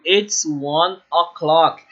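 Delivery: It's one o'clock spoken slowly and clearly; slow model sentence. Shown as a demonstration. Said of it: "eu digo". (frase modelo falada devagar)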